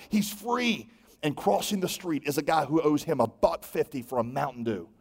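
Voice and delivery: usual speaking voice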